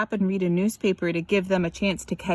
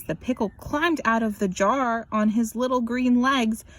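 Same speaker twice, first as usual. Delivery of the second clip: ordinary delivery, nothing marked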